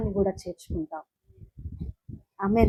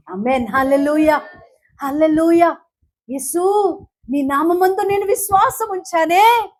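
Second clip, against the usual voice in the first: pleading prayer, raised and loud; fervent Telugu prayer-preaching; preaching at full force; 10 semitones higher, 8 dB louder than usual. Acted. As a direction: cry out to Jesus from the pulpit. Voiced prayer preached aloud.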